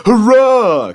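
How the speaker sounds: very high, loud cheer; joyful cry. Acted. Henry cheering at the driver's news, loud and high. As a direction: burst out with delight. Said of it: "cried Henry".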